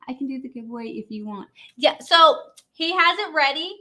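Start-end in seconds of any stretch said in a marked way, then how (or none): none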